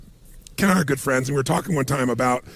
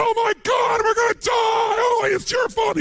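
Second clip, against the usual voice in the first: panicked screaming; slower than usual. Retold or imitated. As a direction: scream it like you think you're about to die. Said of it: "He's screaming at the other guy".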